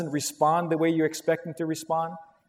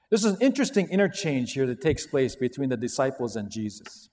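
normal talking voice

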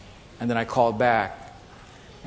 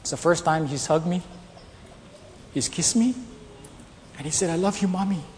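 retold whisper; 2 semitones higher, 6 dB quieter than usual. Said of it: "she whispered to me".

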